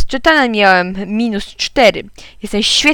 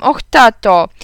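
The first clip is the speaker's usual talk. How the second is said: loud whiny plea to dad, repeated; pleading cry again; 8 dB louder, slower than usual. Acted. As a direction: beg again with the same wail. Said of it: "zawołałem". (głośne błagalne jęczenie)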